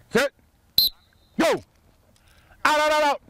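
high loud repeated shout; yelling. Real, not acted. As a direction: shout them out fast. Coach yelling players out.

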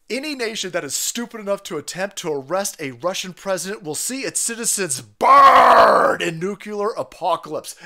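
forceful reading, exploding loud on BURNED; dramatic threat. Acted. Reading out a warning over the Russian president, dramatic threat. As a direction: deliver a statement with booming menace.

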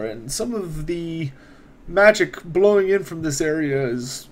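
a hint of a courteous court wizard, unhurried; wizard NPC voice.

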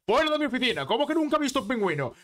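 indignant, high-pitched telling-off; furious boss. Acted. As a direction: act out an outraged boss firing someone. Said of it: "se pone cabreadísimo y le mira y le dice".

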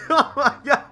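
high, helpless laughter over the words; cracking up. Real, not acted. Streamer amused by a lying trader, cracking up. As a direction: lose it laughing mid-exclamation.

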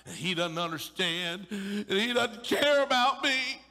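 rising to a loud high mock wail; hammed-up whiny grievance; mock whining complaint. Mocking the mourners' complaint.

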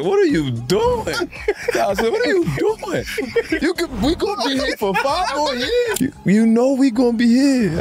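high-pitched teasing imitation; hammed-up high imitation of a coach; high mock voice. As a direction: squeak it like a friend ribbing you. Imitating Tibbs asking.

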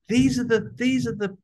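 put-on high, eager child calling his mum; excited little kid. Boy tugging his mum in the toy shop, high and excited. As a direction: squeal like a boy who just spotted toys. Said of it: "I said to my mom".

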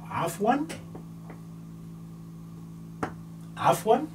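Afwan repeated with a rising tune; repeated model. Shown as a demonstration. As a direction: drill Afwan twice for students. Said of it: "So that's a Ain".

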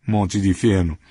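usual speaking voice

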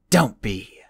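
a loud curt snap; snapped. Acted. The old woman snapping at Flamel, loud and curt. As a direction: cut him off sharply. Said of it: "the old woman snapped".

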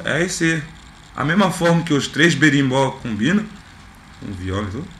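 ordinary delivery

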